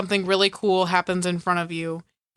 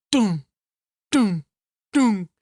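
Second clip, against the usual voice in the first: booming "doom" with a sharp drop; spaced, punchy countdown thuds; imitated bass hits; 4 dB louder, slower than usual. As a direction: punch each one like a deep bass hit. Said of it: "like, deep bass hits".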